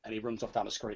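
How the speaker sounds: normal talking voice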